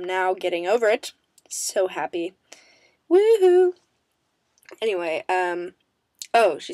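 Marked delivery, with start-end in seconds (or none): 3.1-3.7 high, loud excited cheer; squealing celebration; woohoo cheer. Real, not acted. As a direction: let out a happy whoop. Celebrating getting over strep.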